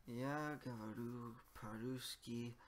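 ordinary talk, nothing marked

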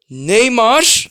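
calls the name out loud and high; a thrilled yell of the player's name; excited shout. Real, not acted. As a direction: gamer hyped over his pick.

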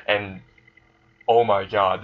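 ordinary delivery, nothing marked

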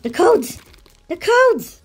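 high-pitched excited cries; thrilled discovery. Real, not acted. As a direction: gasp and squeal at the jackpot. Finds the codes he wanted.

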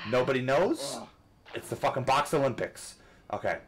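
usual speaking voice

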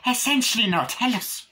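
"Essentially" very high and loud; mock yourself with a high, overdone "Essentially"; exaggerated self-mockery. Shown as a demonstration. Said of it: "the way I say".

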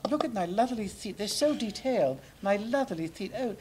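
prim English gentleman puppet, preening; British puppet. Pretend. Holds British puppet character.

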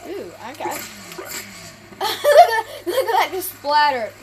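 laughing through the words. Real, not acted. Gamer laughing at a messy in-game kill. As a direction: giggle while you point it out.

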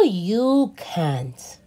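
put-on slow, expressive read-aloud for kids; reading to children. A narrator's reading. Teacher reading a story to children, animated and slow. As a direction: read it like a picture book for little ones. Reads picture book aloud.

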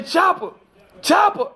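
high rising call of the dog's name; mimicking cop calling dog.

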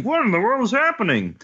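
high-pitched bewildered cry; alarmed bystander. Acted. Someone on the boat in the chaos, alarmed cry. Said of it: "someone on the boat is saying".

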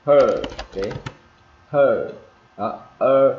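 loud, stretched "er" in "her"; emphatic falling "her", tongue curled up. Shown as a demonstration. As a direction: hold the R at the end of "her". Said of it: "ra cong cái đầu lưỡi lên".

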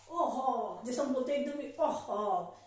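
usual speaking voice

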